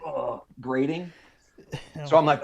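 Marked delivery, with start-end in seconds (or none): none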